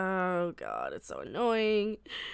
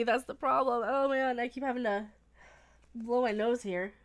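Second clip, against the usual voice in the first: usual speaking voice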